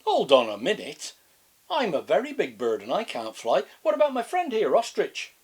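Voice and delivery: high, loud indignant emu; protesting emu. Acted. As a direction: complain like a big upset bird. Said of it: "It was the emu".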